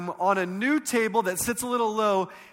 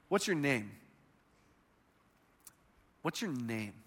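soft, lowered question; gentle question; 8 semitones lower, 9 dB quieter than usual. Acted. Jesus asking the possessed man his name, gentle and low. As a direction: ask it quietly and low.

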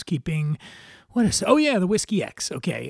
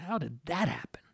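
whisper; 10 dB quieter than usual. Acted. Conspiratorial aside in essay.

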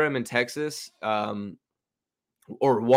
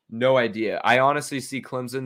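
normal talking voice

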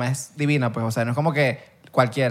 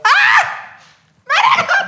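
chillido agudísimo y alargado de fan; grito de emoción imitado; 6 dB louder than usual. Retold or imitated. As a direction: grita fuerte, imitando emoción desbordada. (chillido de fanático emocionado, exagerado)

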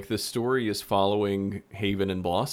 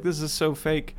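scoffing skeptic; 8 semitones higher than usual. Retold or imitated. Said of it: "people that are just like".

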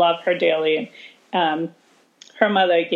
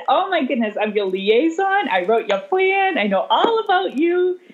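exaggerated surprise; 7 semitones higher than usual. Teacher confronts student, exaggerated surprise.

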